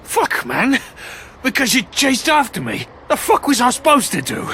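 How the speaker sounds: loud, high, exasperated defence; heated protest. Acted. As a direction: yell back in self-defence. Suspect defends himself angrily.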